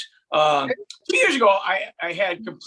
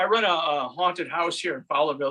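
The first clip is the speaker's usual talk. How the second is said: usual speaking voice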